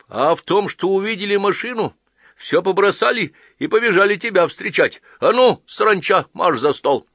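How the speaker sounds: high, loud bossy mother voice; shrill scolding order. A narrator's reading. Wife ordering the children back to dinner, high and loud. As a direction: shoo the kids to the table.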